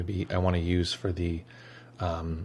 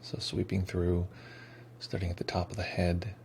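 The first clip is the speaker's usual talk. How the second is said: guided meditation; 7 dB quieter than usual. Acted. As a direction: ease them into stillness, unhurried. Leads the meditation.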